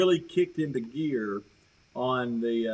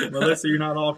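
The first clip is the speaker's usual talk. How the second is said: words riding on real laughter, louder; teasing laugh; 3 semitones higher, 7 dB louder, faster than usual. Real, not acted. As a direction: tease her while still chuckling. Teasing a viewer amid laughter.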